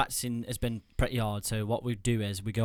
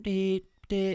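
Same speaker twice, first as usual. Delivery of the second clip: high imitated phone tone; mimicked machine signal, high-pitched; payphone tones; 8 semitones higher than usual. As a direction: sing out two electronic dee sounds.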